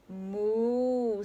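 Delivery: a drawn-out cartoon moo; cow moo. A narrator's reading. Cow answers with a lowing moo. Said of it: "said Cow".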